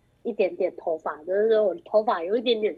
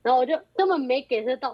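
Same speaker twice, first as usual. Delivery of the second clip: ordinary talk, nothing marked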